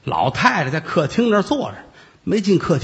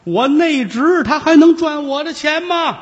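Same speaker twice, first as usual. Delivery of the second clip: put-on muddled old aunt; 11 semitones higher, 3 dB louder than usual. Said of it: "老太太糊涂".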